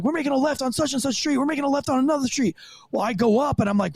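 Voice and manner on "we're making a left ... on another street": higher, quick radio dispatcher read. Retold or imitated. Rookie cop imitating the radio dispatcher, lightly voiced. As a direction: rattle it off like a radio call. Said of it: "they're like".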